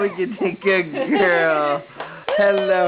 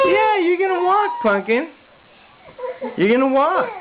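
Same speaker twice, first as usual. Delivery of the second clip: high, quick cheering on; excited cheering; 9 semitones higher, faster than usual. Real, not acted. Father cheering baby's walking.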